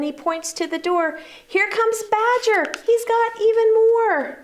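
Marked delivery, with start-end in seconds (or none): 1.5-4.4 excited hare. Acted. Hare's excited voice.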